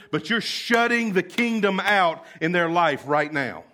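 loud, high indignant rebuke to the disciples; Jesus rebuking his disciples, voice straining; Jesus scolding his disciples with loud fervent anger. Retold or imitated. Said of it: "can you hear that in his voice".

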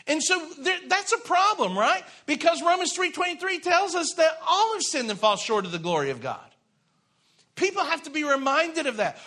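high, loud, driving sermon delivery, every phrase pressed home; preaching at full force. Acted. Pastor urging the congregation on sin and grace, preaching high and loud. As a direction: lift the room like a revival preacher.

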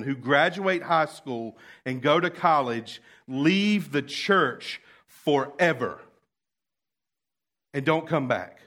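loud, slowed impassioned sermon; preacher building to full force; fiery preaching. Acted. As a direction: preach it hard to the congregation.

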